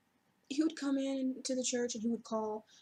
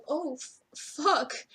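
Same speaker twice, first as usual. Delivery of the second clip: alarmed outburst; 6 semitones higher, 6 dB louder, much slower than usual. Retold or imitated. Panicking at the banging door.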